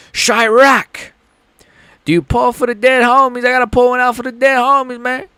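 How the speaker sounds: high, put-on Chicago drill slang voice; Chicago street voice. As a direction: play a Chicago street dude calling out loud. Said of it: "See, I could do the".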